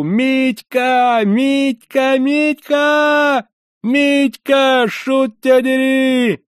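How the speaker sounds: very high, loud yelling of a name; screaming at the top of lungs. A narrator's reading. Man tumbling downstairs screams after Mitka at full throat. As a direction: scream after him with all you have. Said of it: "крича во всю глотку".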